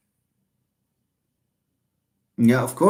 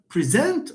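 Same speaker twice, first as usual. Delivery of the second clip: preSENT, weight thrown on SENT; end-stressed "present"; wrong second-syllable stress; 7 semitones higher than usual. Shown as a demonstration. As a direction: say "present" with a weak first and strong second syllable. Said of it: "You can't say it like that".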